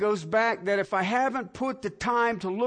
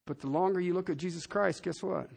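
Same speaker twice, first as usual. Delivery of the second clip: dropped to a hush; 5 semitones lower, 7 dB quieter than usual. Real, not acted. Preacher drops voice for point.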